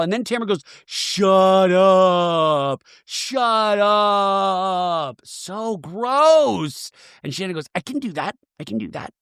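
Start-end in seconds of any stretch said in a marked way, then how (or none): 0.8-6.9 long drawn-out, put-on moan; hammed-up, elongated telling-off; mock exasperated. Acting Tamara telling Shannon off. 7.7-9.1 soft, under-the-breath mock retort; hushed, repeated self-defence; muttered comeback. Acting Shannon's muttered comeback.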